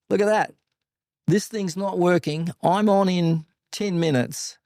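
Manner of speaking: high, urgent call to the crowd; urgent shout. Retold or imitated. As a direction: demand help from the whole pit.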